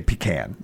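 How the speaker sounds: "pecan" ending with the short a of "can"; pee-CAN. Shown as a demonstration. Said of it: "more fun to say".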